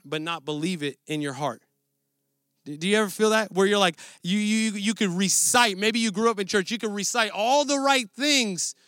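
ordinary delivery

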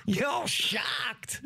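high shouted taunt; mocking redheaded kid. Quoting the video's punchline to friends, high shouted mockery. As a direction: yell it high like a smug kid. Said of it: "And then run it again".

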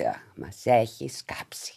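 slow, low whisper from the back; muttered aside. Retold or imitated. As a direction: imitate men grumbling under their breath. Men grumbling from behind, quoted.

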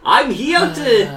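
a badly faked, drawn-out Australian accent; mock Australian accent. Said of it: "what part of Australia".

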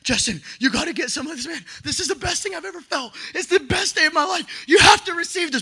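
high tearful plea building to a shout; fervent pleading. Retold or imitated. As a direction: beg a friend, voice climbing with urgency. Acts out pleading with his friend.

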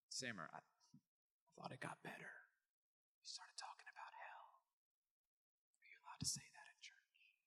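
whispering, worried churchgoer; mock scandalized whisper. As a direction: hush it like a shocked pew neighbour. Congregant's alarmed aside.

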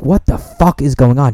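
alarmed shout. Retold or imitated. Roommate bursting out.

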